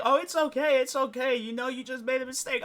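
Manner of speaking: high, cooing mock comfort; mock sympathetic voice. Mocking sympathizers cooing at the crying suspect. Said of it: "they're like".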